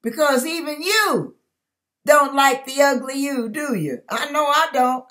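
normal talking voice

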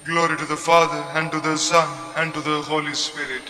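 ordinary talk

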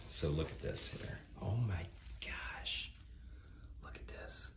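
hushed, unvoiced-breath whisper of amazement; awed whisper. Real, not acted. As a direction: whisper it as if stunned by a gift. Awed unboxing of gift miniatures.

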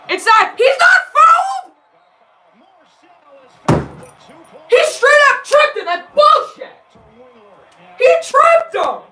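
screaming high and loud at a bad call; outraged yell at the referee; furious yelling. Real, not acted. Streamer yelling at the TV over a missed foul call, furious. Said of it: "I'm sorry for yelling".

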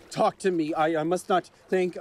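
usual speaking voice

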